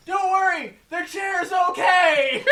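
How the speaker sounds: high, loud, drawn-out holler; mock crowd shout. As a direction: shout it across a hall like the crowd. Acting out everyone calling out.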